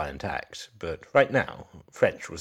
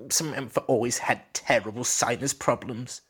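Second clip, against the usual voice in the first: higher, trembling, on edge; shaky voice; 7 semitones higher than usual. Acted. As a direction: voice shaking with nerves. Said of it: "the man answered in a shaky voice".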